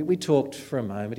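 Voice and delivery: ordinary talk, nothing marked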